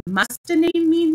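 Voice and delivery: doubtful question, lifted high. A narrator's reading. Alice questioning Humpty Dumpty about names, doubtful. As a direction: ask it loudly in high-pitched doubt. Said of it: "Alice asked doubtfully".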